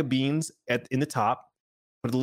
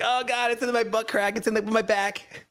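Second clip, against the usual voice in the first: imitating the squirming baby; 11 semitones higher than usual.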